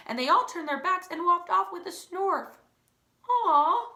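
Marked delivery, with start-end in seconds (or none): 3.3-3.9 high, loud pitying aw; sympathetic aw. Real, not acted. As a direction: feel sorry for the poor moose. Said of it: "sympathy for the moose".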